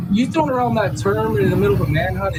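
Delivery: ordinary talk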